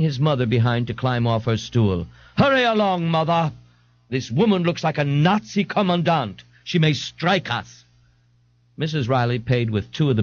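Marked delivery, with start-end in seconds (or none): 2.4-7.8 high, pompous and fussy; pompous man. Acted. Ignatius voiced.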